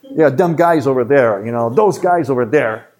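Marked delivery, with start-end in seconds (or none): 0.3-1.4 imitated Chicago tough-guy accent. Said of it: "they talk like this". 1.7-2.9 put-on drawn-out Chicago accent, THOSE as dose; slow imitation of a Chicago local. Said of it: "that's how I know you're from Chicago".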